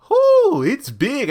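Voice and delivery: loud, very high gasp of amazement; mock shock. Reacting to seeing Oliver exposed, mock shock. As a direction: squeal it out, hammed-up.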